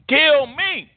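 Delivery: high-pitched, drawn-out mock cry. Flesh crying out to be destroyed, high wail. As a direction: cry it out far above your preaching pitch. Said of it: "flesh is crying".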